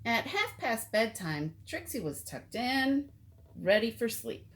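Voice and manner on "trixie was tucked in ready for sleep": usual speaking voice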